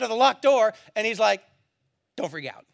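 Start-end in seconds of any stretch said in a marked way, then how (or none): 2.2-2.7 low, soft, understated mock calm; casual reassurance. Jesus appearing among startled disciples, comically understated. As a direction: toss it off quietly, as if nothing happened.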